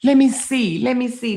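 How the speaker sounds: high, loud urgent demand; panicked let me see; frantic let me see. Retold or imitated. Wife to husband, wanting proof: high frantic demand. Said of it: "I'm like".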